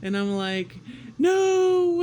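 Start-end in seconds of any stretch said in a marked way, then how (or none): 1.2-2.0 high, loud cry of no; panicked refusal. Retold or imitated. Refusing the nurse's nose swab, panicked shout. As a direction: shriek it out in panic.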